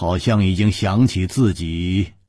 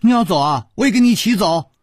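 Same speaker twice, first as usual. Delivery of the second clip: high, raised impulsive voice; loud declaration; 14 semitones higher, 4 dB louder, faster than usual. A narrator's reading. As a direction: blurt it out loudly and high. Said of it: "宋田儿大声说". (大声嚷着要一起走)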